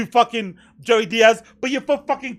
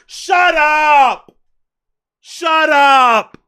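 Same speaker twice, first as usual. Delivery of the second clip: mock yell; 3 semitones higher, 7 dB louder, much slower than usual. Mocking gangster dismissal.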